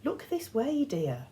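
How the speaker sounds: high sweet scolding; a light reproach, sugary; mild chastising. A narrator's reading. Old woman chiding the boy, lightly sweet. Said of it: "Emily chastised".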